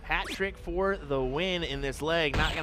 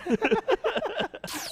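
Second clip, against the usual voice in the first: laughing. Real, not acted. Laughing at the story.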